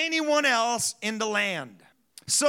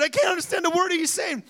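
higher, quick exasperated shout; mock shout; 7 semitones higher, faster than usual. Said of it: "He said".

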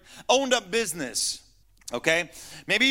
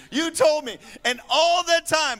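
higher, louder whining complaint; whining older son; 8 semitones higher, 5 dB louder than usual. Retold or imitated. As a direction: pile on the grievance, higher and louder. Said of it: "That's not Junior".

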